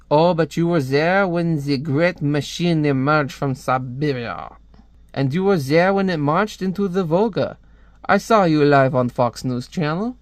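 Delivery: hammed-up French accent; a Frenchman recounting events in a theatrical accent. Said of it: "I hate doing a French accent".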